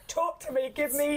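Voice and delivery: playfully imitated smitten friend; love-struck. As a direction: tease her with a lightly swooning voice. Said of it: "she was so love-struck".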